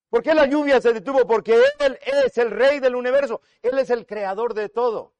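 fired-up pulpit declaration; impassioned proclamation. Real, not acted. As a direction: proclaim it high and forceful to the congregation. Preacher proclaims at full force.

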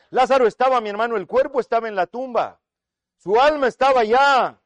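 forceful pulpit voice; impassioned preaching. Real, not acted. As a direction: press the point home at high volume.

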